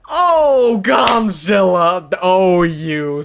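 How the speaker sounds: high, loud, stretched-out cry of surprise; excited shout. Real, not acted. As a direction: shout the donor's name in delight. Donation alert surprises streamer.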